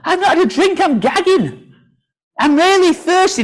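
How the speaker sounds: high-pitched, hammed-up thirsty plea; pretend desperate thirst.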